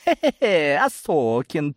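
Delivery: menacing chuckle into a growled name. A narrator's reading. Gromova corners Osokin in the hall, growling. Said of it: "прорычала Громова".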